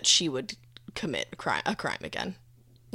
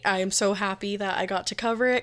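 ordinary delivery

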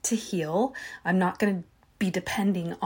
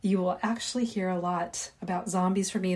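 ordinary talk

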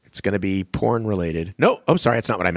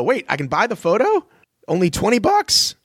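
mock surprise; 10 semitones higher than usual.

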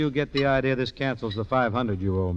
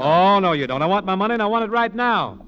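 louder, higher, won't let him go; angry demand; 5 semitones higher, 4 dB louder than usual. Acted. Emmett stopping the crook walking off, angry demand. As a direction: block his exit and insist.

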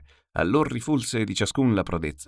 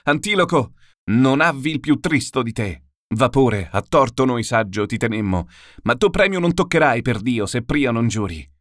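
louder, higher angry reproach; indignant cry; 5 semitones higher, 5 dB louder than usual. A narrator's reading. Menelaus shouting after Antilochus who cheated him, indignant cry. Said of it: "l'Atride gridar s'udiva".

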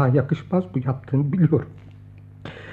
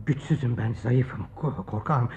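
softer, lower, stammering fear; timid frightened confession; 5 semitones lower, 7 dB quieter, slower than usual. Acted. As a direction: shrink away and stammer. Frightened friend confesses weakness. (ürkek, kekeleyen itiraf)

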